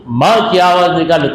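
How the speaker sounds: normal talking voice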